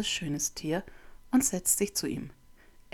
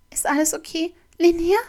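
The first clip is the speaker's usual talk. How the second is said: hoch und laut vor Angst; ängstlich; 12 semitones higher, 12 dB louder than usual. Acted. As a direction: panisch besorgt rufen. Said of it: "Tia klingt ängstlich". (ängstlicher, hoher Ruf)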